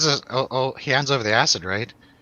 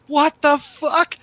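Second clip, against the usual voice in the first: high, drawn-out mock freak-out; imitated panic; 3 dB louder, much slower than usual. Imitating the friend looking around the dance floor, panicked. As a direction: stretch each word, pitched way up in mock panic. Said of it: "pure panic on his face".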